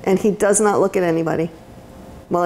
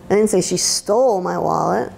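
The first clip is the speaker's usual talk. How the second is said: stole lifted on a rising tune; stress on STOLE. Shown as a demonstration. Teacher showing the audience how emphasis changes meaning, stress on stole. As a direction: lean into the word stole. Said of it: "every word that you put emphasis on".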